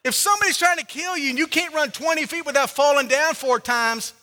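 loud, high, driving sermon voice; full-force preaching. Acted. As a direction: preach it hard to the crowd.

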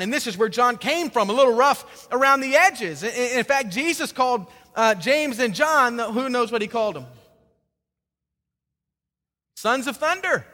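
ordinary talk

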